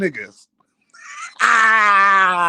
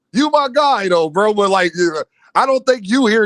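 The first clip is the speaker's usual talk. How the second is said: ordinary talk, nothing marked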